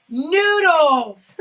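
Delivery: loud exasperated shout. Retold or imitated. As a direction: yell the correction. Daughter shouting correction.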